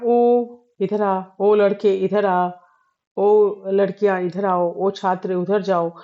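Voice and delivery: ओ pulled long and pressed, slow calls; drawn-out calling ओ. Shown as a demonstration. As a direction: lean hard on each ओ, as if calling someone far away. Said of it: "ओ शब्द पे जो बल आता है पूरा". (ओ पर पूरा बल देकर पुकारना)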